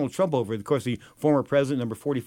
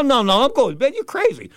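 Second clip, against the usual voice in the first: mock refusal; 9 semitones higher, 5 dB louder than usual. Refusing the boss late at night.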